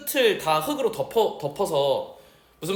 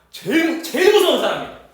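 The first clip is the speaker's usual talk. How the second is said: ordinary talk, nothing marked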